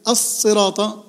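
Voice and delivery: الصراط with a long held S; doubled sad. Shown as a demonstration. Teacher showing the shadda, long double S in الصراط. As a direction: slowly lengthen the heavy S in الصراط. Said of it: "فيها شدة".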